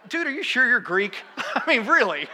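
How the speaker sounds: high, incredulous tease through laughter; laughing disbelief. Retold or imitated. Son teasing dad about being Greek, laughing disbelief. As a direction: tease him, laughing as you doubt him.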